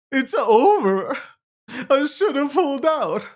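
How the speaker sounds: hammed-up crybaby wail; mock crying. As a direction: whine it like a crybaby holder. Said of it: "crying on the fucking timelines".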